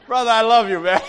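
high voice breaking up with laughter; laughing affection. Real, not acted. Preacher teasing brother Ray, laughing affection. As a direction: say it through helpless laughter.

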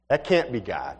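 low, falling "can't be God"; dismissive doubt. Acted. Young man brushes off a strange thought, low doubtful statement.

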